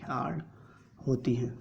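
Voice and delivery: ordinary talk, nothing marked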